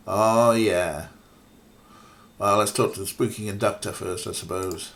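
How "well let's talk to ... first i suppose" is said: usual speaking voice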